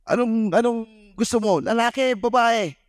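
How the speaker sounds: high, teasing quiz voice; teasing host. Retold or imitated. Host quizzing the son at the party, high and teasing. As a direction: tease the boy with a choice.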